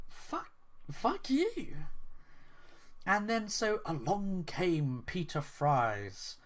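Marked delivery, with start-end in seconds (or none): 0.1-1.8 high, slow mock curse; angry crowd. Angry fans replying to the developer, high slow mock curse. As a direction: stretch it out in a high put-on voice. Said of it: "They're like".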